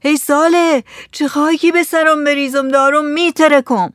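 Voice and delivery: high-pitched distressed call; loud cry. A narrator's reading. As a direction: cry out to husband, about to burst. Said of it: "با صدای بلند داد زد".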